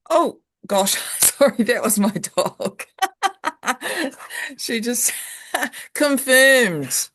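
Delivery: giggling through an apology; laughing it off, embarrassed. Real, not acted. Dog interrupts the call.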